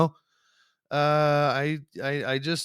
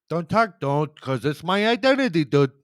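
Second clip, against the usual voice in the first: put-on Milwaukee accent; 5 semitones higher, 6 dB louder than usual. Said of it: "I don't know why I did a, a Milwaukee".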